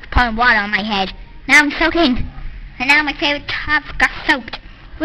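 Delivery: ordinary delivery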